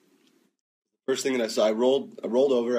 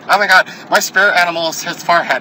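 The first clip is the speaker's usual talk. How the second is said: lightly high, louder gushing fan voice; mock fangirl; 5 semitones higher, 5 dB louder than usual. Mocking fans about his forehead, a gushing fangirl voice. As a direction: play a swooning fan.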